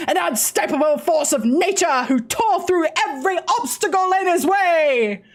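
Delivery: grand, slow ceremonial announcer. Acted. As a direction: proclaim it to the whole arena. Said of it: "Can we get this voiced? ... fine".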